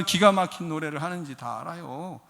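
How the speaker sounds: ordinary talk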